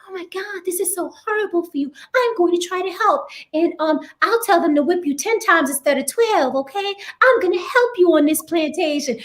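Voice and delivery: high, breathy mock pity. Slave film ally mocked offering false help. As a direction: play up a condescending helper.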